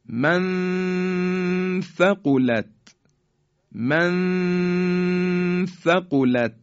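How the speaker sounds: من ثقلت with a long high hum on the n; ikhfa recitation. Shown as a demonstration. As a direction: hold من very slowly as a steady nasal tone, then release ثقلت. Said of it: "این طرز اخفای".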